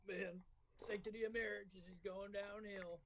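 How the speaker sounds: slow Southern drawl imitating dad; Southern accent. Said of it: "sounds like your fucking dad's from the South".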